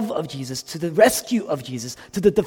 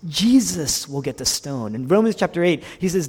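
usual speaking voice

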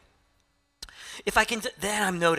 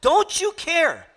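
much higher and louder, venting at Jesus; frustrated outburst; 10 semitones higher, 6 dB louder than usual. Retold or imitated. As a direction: Martha snapping at her guest, stretched-out Don't you care. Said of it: "takes it out on Jesus and says".

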